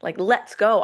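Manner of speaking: pumped up. Acted. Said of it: "you're just like".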